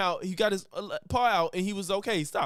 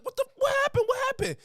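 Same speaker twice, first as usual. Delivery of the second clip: sudden shrill alarm, much higher; alarmed high squeal; much faster than usual. Retold or imitated. Telling friends how she reacts when her cat sounds distressed, panicked high cry. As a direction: yelp it out like you just heard your pet cry. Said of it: "I'm like".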